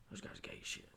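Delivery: unvoiced whisper, very soft; hushed aside. Drunk guy confiding about the group, whispered. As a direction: whisper it like gossip.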